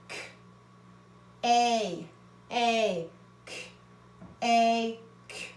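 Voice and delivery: slow letter-by-letter blending; teacher sounding out K-A-K. Shown as a demonstration. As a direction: sound out each letter apart. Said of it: "That E doesn't make a sound".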